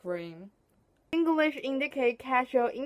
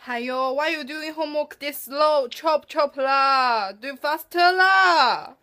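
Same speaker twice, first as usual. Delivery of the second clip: Singlish; 4 semitones higher, 6 dB louder than usual. Shown as a demonstration. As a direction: scold in Singlish, building to a shout. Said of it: "'cause I can speak Singlish".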